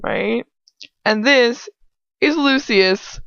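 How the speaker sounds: ordinary talk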